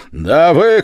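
high, very loud retort; explosive angry cry; fearsome shout. A narrator's reading. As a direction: shout it so the sleepers wake. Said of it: "крикнул я так страшно".

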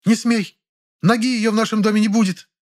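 high, indignant outburst; angry mother. A narrator's reading. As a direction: snap it at him furiously. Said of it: "гневно фыркнула".

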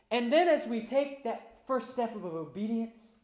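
rising, loud preacher's cry; full-force preaching. Acted. Pastor to congregation, preaching at full force. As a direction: lift the room toward the table.

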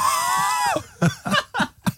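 high wheezing honk laugh; imitated wheezy laugh. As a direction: wheeze like an asthmatic emu. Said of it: "that noise that your boyfriend makes".

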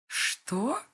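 high, startled cry; shocked exclamation. A narrator's reading. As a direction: gasp it out in shock. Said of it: "восклицает мать".

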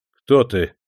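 strict and firm; stern. A narrator's reading. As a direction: demand it like an officer. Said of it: "строго спросил он чиновника".

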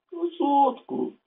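put-on slow, high old man's reply; old grandpa voice. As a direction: play the grandpa answering slowly.

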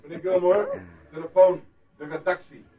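loud, high call across the room; announcing a phone call across the pub. Acted. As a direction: call him to the phone over the noise. Barman calls guest to phone.

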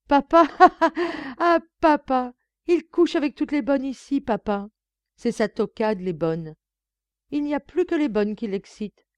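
high, loud acted guffawing; bursting into laughter. A narrator's reading. Son mocking his father's taste for maids, roaring with laughter. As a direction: crack up and talk through the laughter. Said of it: "Son rire redoubla, éclata tout à fait".

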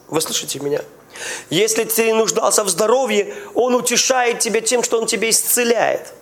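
normal talking voice

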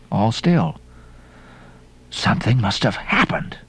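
ordinary delivery

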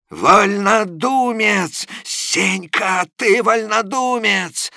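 a bully lecturing a sick man; mocking, indignant rant; scolding clerk. A narrator's reading. Quarrel between lodgers.

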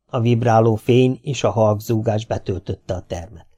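usual speaking voice

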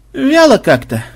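said through a yawn. Acted. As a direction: yawn the words out. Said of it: "зевнул Макмед". (сквозь зевок)

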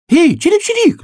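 very high, loud chirping; piercing little bird cry; shouting sparrow. A narrator's reading. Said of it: "закричал воробей".

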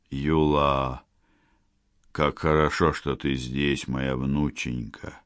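put-on weak, soft old man's voice; faint and tender, fading; dying grandfather.

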